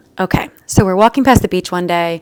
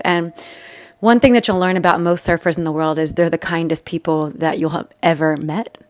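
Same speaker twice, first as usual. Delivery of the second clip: ordinary talk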